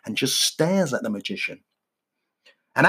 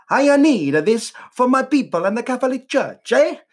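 mock Italian Pope; 9 semitones higher, 4 dB louder than usual. Pope voiced in joke, Italian-ish.

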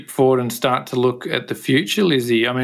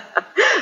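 laugh. Real, not acted. Self-deprecating joke about his accent.

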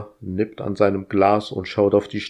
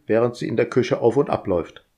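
usual speaking voice

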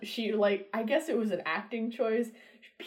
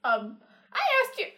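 high, sputtering and indignant; flustered Darcy; 12 semitones higher than usual. Retold or imitated. Friends retelling Darcy being turned down, flustered high sputter. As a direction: splutter like a spurned suitor. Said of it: "He's just like".

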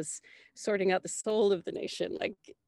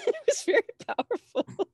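laughing; 5 dB louder than usual. Real, not acted. Laughing to the host about nearly fainting. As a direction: laugh through it, higher and louder.

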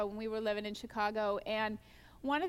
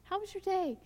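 How was your day tossed off higher and lighter; breezy asking; 6 semitones higher than usual. Shown as a demonstration. Friendly greeting without eye contact, breezy. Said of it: "you're not really looking them in the eye".

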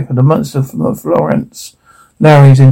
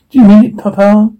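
lightly voiced young girl, higher and eager; 7 semitones higher than usual. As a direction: ask like an eager little girl, pitched up. Daughter asks father hopefully.

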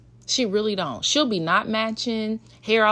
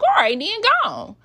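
miffed dismissal; 12 semitones higher, 7 dB louder than usual. Retold or imitated. Mom miffed at daughter's refusal.

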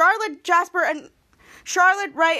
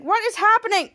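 higher, louder alarm; panicked question; 6 semitones higher, 4 dB louder than usual. Retold or imitated. Henry in the dark, higher frightened question. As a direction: ask in a fright.

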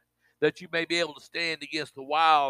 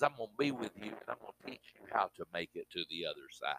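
much lower and softer, hushed and gentle; tender voice of God; 9 semitones lower, 13 dB quieter, faster than usual. Acted. Preacher voicing God softly to the congregation, hushed and tender. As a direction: whisper God's promise like comfort.

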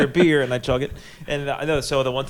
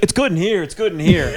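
higher, louder, casual mock voice; a caught guy playing it cool; imitating lifeguard; 5 semitones higher, 4 dB louder than usual. Caught lifeguard reassures the coworker walking in, casual mock voice. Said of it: "he's like".

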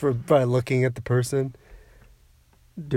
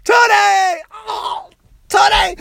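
dramatic cry; 5 dB louder, slower than usual. Acted. Mock pleading with Tony.

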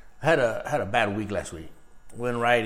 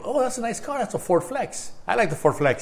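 high, admiring voice; a high, impressed little gush; mock admiring; 9 semitones higher than usual. Retold admiring self-talk.

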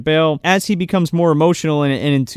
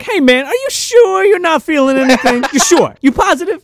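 much higher, louder needling voice; hammed-up smug partner; smug Brant; 12 semitones higher, 5 dB louder than usual. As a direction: needle him like a self-satisfied cop. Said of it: "Him being very full of himself".

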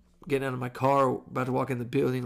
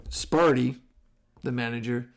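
ordinary talk, nothing marked

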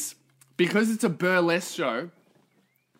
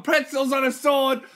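high-pitched carrying vendor's cry; hawker's yell; 9 semitones higher, 5 dB louder than usual. Retold or imitated. Imitating a waitress selling food round the venue, yelling. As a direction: call it out across a crowded room. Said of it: "and they all yell".

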